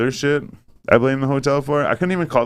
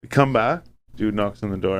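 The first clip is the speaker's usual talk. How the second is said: ordinary delivery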